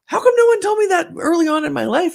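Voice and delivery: suddenly realising nobody told her; surprised realisation. Retold or imitated. Patient retelling to host: high, astonished.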